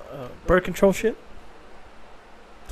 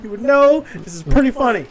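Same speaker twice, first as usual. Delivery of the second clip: laughing; 7 semitones higher than usual. Retold or imitated. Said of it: "And I was like".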